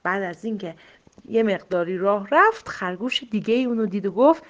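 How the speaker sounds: ordinary delivery, nothing marked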